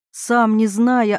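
usual speaking voice

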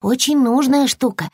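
high-pitched squirrel voice; high, insistent little character; cross little squirrel. Acted. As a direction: lecture in a small creature's high voice. Squirrel's dialogue in story.

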